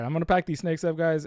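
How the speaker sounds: ordinary talk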